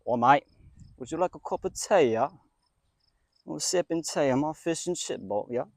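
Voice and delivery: mock British chap offering tea; British accent. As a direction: put on a jokey British voice. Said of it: "Let me do a British one".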